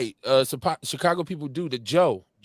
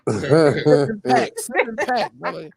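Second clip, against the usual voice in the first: joking pun; 10 semitones higher, 4 dB louder, slower than usual. Pretend. Joking about sip and paint.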